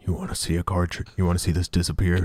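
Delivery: low, creepy magician murmur; creepy David Blaine. Acted. David Blaine offering card tricks to strangers, low and creepy. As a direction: pitch it down, creepy and softly insistent. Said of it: "Yeah, in his creepy voice".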